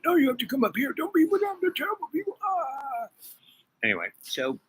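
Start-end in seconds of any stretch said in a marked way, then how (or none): 0.1-3.1 high, put-on voice mimicking his plea; mocking man's voice. High mock voice of the man pleading on the phone. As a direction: pitch it way up to send up his begging.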